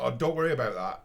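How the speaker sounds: "Oh, don't worry about that" tossed off fast and airy; breezy brush-off. Retold or imitated. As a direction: brush it aside quickly. Retelling the dismissive reply.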